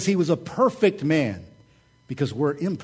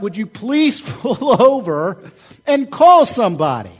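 mock annoyed wife; 8 semitones higher, 10 dB louder than usual. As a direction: play the fed-up wife, half laughing. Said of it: "was so mad at me".

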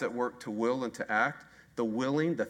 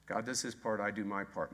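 wry quiet slogan; 5 semitones lower, 7 dB quieter than usual. Shown as a demonstration.

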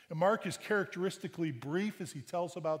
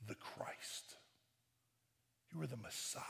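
whispered, much softer; hushed confession; 3 semitones lower, 11 dB quieter than usual. Acted. Peter confessing to Jesus in an awed whisper. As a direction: breathe it out in awe.

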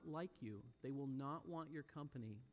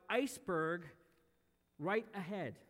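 louder lookout's warning, only half a shout; calling out; 6 dB louder than usual. Retold or imitated. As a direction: call out the alarm, word by word. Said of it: "he called out".

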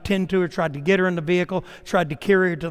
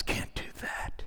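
God's whisper; 13 dB quieter than usual. Acted. God answers the rich man.

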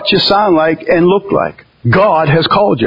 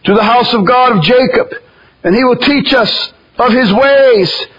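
raised, solemn preaching read; scripture reading; 6 semitones higher than usual. Acted. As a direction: proclaim the verse from the pulpit. Reads scripture aloud.

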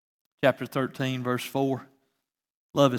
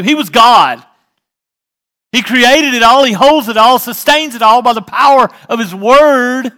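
louder, higher preacher's build; full-force preaching, much louder and higher; 6 semitones higher, 11 dB louder, faster than usual. Acted. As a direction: thunder it out to the church.

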